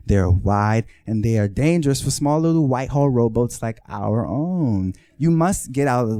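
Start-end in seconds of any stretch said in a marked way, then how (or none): none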